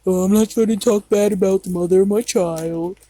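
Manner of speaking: lightly imitating him; mildly mock his odd, formal wording; weird stilted phrasing. Child mimicking how the father spoke of Mom, weird way. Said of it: "He would say in a weird way like that".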